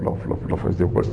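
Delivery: soft, breathless, almost unvoiced; muttered mock complaint. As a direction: toss it off under your breath.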